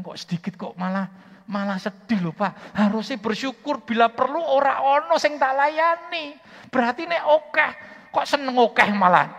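very high, loud rebuke; scolding the warden at full force; indignant shout. Retold or imitated. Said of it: "saya bilang". (teguran keras berteriak)

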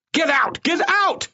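frantic, very high-pitched screech; screaming. A narrator's reading. Doctor drives out his confused guards, screaming. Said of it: "Fabio screamed".

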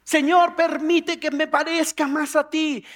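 loud, high, drawn-out petition; acted bold request; fervent prayer. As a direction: call on God with zeal.

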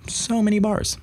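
small high voice on "so many bars"; high-pitched awe. Real, not acted. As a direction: repeat it way up high, marveling. Repeats the phrase in mock awe.

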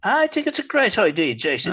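bright mock Irish accent. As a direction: put on a cheery Irish lilt. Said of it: "even in Irish".

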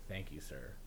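low, soft mock-polite thanks; subdued reply. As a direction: say it small and low. Acting his subdued reply to agent.

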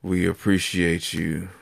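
low, smooth late-night DJ; sexy radio voice. Pretend. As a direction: croon it like a slow-jam radio host. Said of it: "sexy radio voice".

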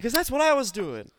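high, loud hammed-up frontman; mock the singer's banter. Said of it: "he's like".

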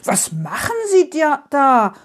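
storybook outrage, voice jumping up; indignant. A narrator's reading. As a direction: call out in outraged protest. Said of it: "Frau Usebuse ist empört".

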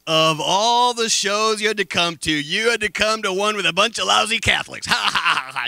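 Phil's jeering joke ending in a laugh; mocking laugh. Phil Donahue mocks the Catholics in the audience, laughing. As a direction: poke fun, then laugh it off. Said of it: "he's laughing".

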